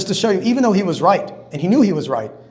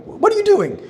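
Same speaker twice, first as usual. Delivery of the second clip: high-pitched, indignant outburst; mock outraged protest. Reacting to someone who sneezed on him, mock outraged. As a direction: squawk it in exaggerated disbelief.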